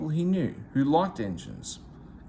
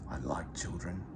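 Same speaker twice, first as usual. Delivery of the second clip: much softer and lower, whispered; happy whisper; 8 semitones lower, 10 dB quieter than usual. A narrator's reading. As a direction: breathe it fondly, almost silent. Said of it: "he whispers happily".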